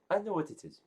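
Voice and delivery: high, quick know-it-all line; smug knowing. Acted. Guild head appraising the dagger instantly, high and smug. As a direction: toss it off smugly.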